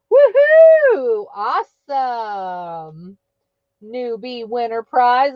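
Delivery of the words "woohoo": big high whooping cheer. Real, not acted. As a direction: whoop it out for the winner. Cheering a giveaway winner.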